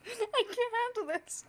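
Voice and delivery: high, tearful wail; real happy tears; crying with joy. Real, not acted. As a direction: voice cracking with overwhelmed joy. Overwhelmed by visitors.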